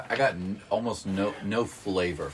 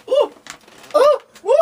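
high, loud hissing oohs at spicy heat; pained ooh from the heat; 13 dB louder than usual. Retold or imitated. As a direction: yelp like your tongue is burning. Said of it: "I was like".

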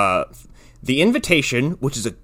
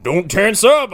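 Jeff Bridges yeehaw cowboy; 7 semitones higher, 4 dB louder, slower than usual. Pretend. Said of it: "I'm Jeff Bridges doing an Yeehaw Cowboy impression".

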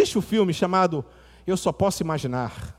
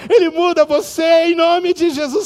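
climbing higher and louder preacher cry; climactic preaching; 11 semitones higher, 6 dB louder than usual. Acted. Preacher proclaiming that Jesus changes lives, climbing to a loud climax. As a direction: drive each phrase up to a peak.

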